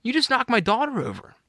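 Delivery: high, indignant mock shout at normal volume; retold yell. Dad confronting guy who hit his daughter, retold yell. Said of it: "I yelled out to the guy".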